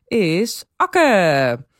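loud triumphant name reveal; excited reveal. Real, not acted. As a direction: shout the winner out. Revealing the winner.

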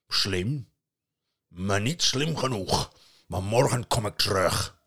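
loud, rough troll taunting. Acted. Troll taunting the parents, loud. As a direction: gloat like a big troll. Troll speaks after Odin leaves.

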